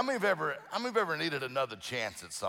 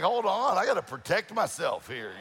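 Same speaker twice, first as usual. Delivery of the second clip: mock alarm; 6 semitones higher, 5 dB louder than usual. Mock alarm at kidnap T-shirt joke.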